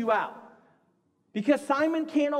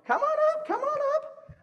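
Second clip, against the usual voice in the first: calling out. Real, not acted. Calling volunteers up to the stage.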